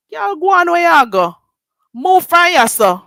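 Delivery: loud, high brush-off; loud high Jamaican brush-off; dismissive shout. Real, not acted. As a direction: shoo her away loudly. Shouting at rival.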